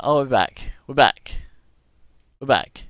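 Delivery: loud, quick announcement to the group; calling out. Real, not acted. Player tells the group voice chat is back, calling out. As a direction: call it out loudly over comms. Voice chat returns after outage.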